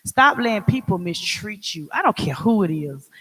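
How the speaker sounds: ordinary talk, nothing marked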